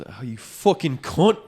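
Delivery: drawn-out put-on British accent; adopted British accent. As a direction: imitate a British bloke cursing a mate. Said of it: "I adopted his fucking accent so fast".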